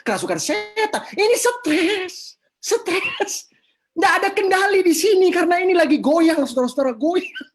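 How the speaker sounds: seru lantang melengking, kata dipanjangkan; seruan gemas. Retold or imitated. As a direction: berseru sangat tinggi, tekankan stres. Berseru bahwa ini cuma stres. (seruan gemas yang lantang)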